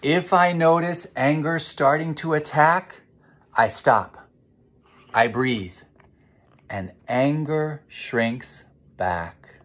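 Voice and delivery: slow, sing-song recital of a calming rhyme; recited refrain. Acted. Teacher reciting the calming rhyme to children, slow and sing-song. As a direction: chant it gently like a mantra.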